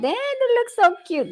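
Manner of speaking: very high, gushing coo. Retold or imitated. Seeing her daughter's dog pictures, cooing high. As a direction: squeal softly over cute pets. Gushing over photos of dogs.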